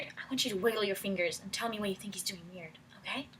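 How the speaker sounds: fast hushed whisper. Real, not acted. Teacher whispering directions to her class. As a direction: whisper the instructions quickly.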